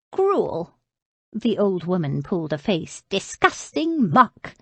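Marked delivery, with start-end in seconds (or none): 0.1-0.7 a high, scornful cry at the bowl; disgusted. Acted. Old lady sneering at the gruel. 3.1-4.6 put-on slow, higher old crone voice full of disgust; disgusted old woman. Bedridden old lady scorning the tray.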